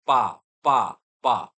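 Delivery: पा with the s silent, a short clear drill word; पा repeated as a pronunciation drill; French pas. Shown as a demonstration. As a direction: say पा with no trailing s. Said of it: "सिर्फ आप पा ही बोल सकते हो".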